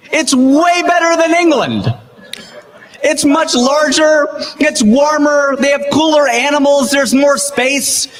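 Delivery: high, slow and emphatic, praising Australia; excited praise. Real, not acted. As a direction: build each item up eagerly.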